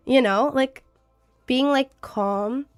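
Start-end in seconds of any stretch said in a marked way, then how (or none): none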